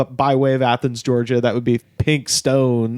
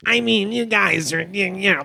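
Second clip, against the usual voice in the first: lightly voiced nerdy guy, higher; a hint of a nerdy nasal-free whine; 5 semitones higher than usual. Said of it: "he has this nerdy voice where he's just like".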